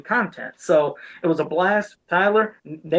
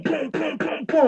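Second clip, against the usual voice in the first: high boom sound effects; mock impact booms; 10 semitones higher, slower than usual. Retelling a video game combo with high booming sounds. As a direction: punch out each boom like game hits.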